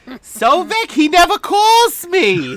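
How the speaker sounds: high, loud Yiddish grandmother wail; Yiddish accent. Acted.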